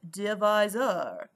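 slow, loud mock-French accent. Shown as a demonstration. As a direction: draw it out in a put-on French accent. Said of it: "Say divisor like a Frenchman".

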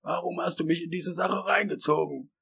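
high-pitched whining reproach; plaintive complaint. Acted. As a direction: complain like you've been dragged into it. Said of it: "klagte Wisconski an Hal gewandt".

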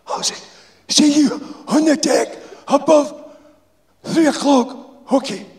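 put-on high, slow old Glaswegian; old insulator voice. A narrator's reading. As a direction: drag it out like the old worker. Insulator speaking in story.

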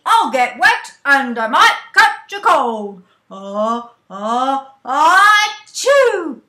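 very loud, belted sneeze verse; forte loud. Shown as a demonstration. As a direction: belt it out with a huge sneeze.

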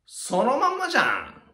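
high, drawn-out exclaim of surprise; mock surprise. Teacher to viewers, hammed-up astonished exclaim. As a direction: act amazed it's so obvious.

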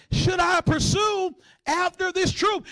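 high, drawn-out plea; calling out to God. Acted. Praying man asks the Lord, calling out high and slow. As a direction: stretch the question out, pitched high.

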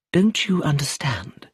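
hushed, breathy whisper; a wife confiding in a whisper. A narrator's reading. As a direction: lean in and whisper it. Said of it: "she asked".

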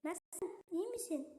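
put-on soft high girly voice; quiet falsetto girl on the phone. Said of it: "Kız sesi yapacağım". (alçak tiz kız sesi)

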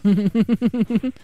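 high, rapid-fire giggle. Real, not acted. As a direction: giggle high in quick pulses. Amused by opponent's shell defence.

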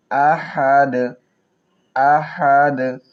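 আহাদ ending in a bounced echoing d; qalqala on dal. Shown as a demonstration. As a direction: stop on আহাদ and let the d bounce. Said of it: "ডালের উপর সাকিন দিয়ে কলকলা করব".

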